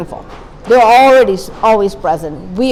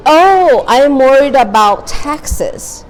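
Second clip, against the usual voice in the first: mild realization; 5 semitones higher, 4 dB louder, much slower than usual. Retold or imitated. Retold self check-in realization.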